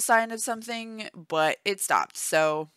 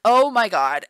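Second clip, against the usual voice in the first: loud, higher startled cry; startled outburst; 4 semitones higher, 8 dB louder than usual. Acted. Pregnant woman as baby drops into pelvis, startled. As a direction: blurt it out, acting a sudden jolt.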